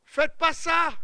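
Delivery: high and loud, pleading warning; sudden loud plea from the pulpit; urgent warning. Real, not acted. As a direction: cry it out to stop them doing it. Preacher warns the congregation.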